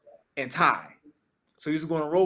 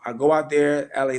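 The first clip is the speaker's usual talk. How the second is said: ordinary talk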